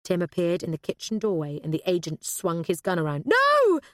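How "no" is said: one loud, high, drawn-out yell; alarmed shout. A narrator's reading. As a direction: shout to stop a gunman. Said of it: "shouted Sally".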